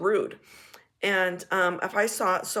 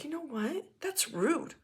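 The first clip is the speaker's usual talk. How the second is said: higher, softer mock-offended voice; indignant friend; 6 semitones higher, 5 dB quieter than usual. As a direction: play her huffy and a bit whiny. Friend turns indignant about pizza.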